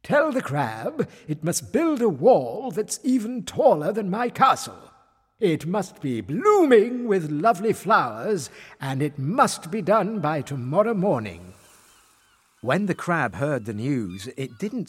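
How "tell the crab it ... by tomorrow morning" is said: raised, emphatic royal voice; commanding king. A narrator's reading. King sets the crab's test through fisherman, commanding. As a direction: lay down the terms as a king. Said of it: "He turned to the fisherman".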